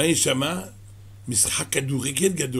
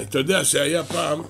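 ordinary delivery

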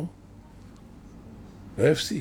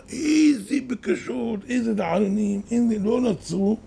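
marvelling; 8 semitones higher than usual. Real, not acted. Marvelling at sages' prayers.

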